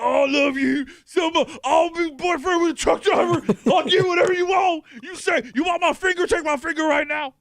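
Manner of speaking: high, loud mock lovestruck fan; smitten male commenter. Host mocking a commenter besotted with a fake woman, hammed-up desperate suitor. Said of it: "This is just some guy".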